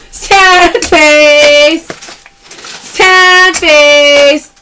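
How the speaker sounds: playful loud callout; loud catchphrase call. Real, not acted. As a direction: announce it to the chat. Live sale callout.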